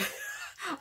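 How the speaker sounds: high-pitched laugh-cry; a squeaky laughing whimper. Real, not acted. Laughing at her own drama.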